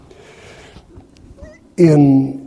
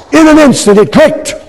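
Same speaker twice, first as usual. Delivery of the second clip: very high, loud ecstatic preaching; preaching at full force; 8 dB louder than usual. Real, not acted. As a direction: cry the name out in rapture. Preacher's ecstatic climax on "Mary.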